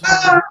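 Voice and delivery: sharp high yelp of pain; pained cry. Acted. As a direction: cry out as the snake bites. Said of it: "He's like".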